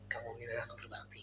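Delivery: faint, breathy whisper of an ailing mother; barely voiced, tender and frail; soft mother's blessing. Ailing mother whispering a blessing to her son.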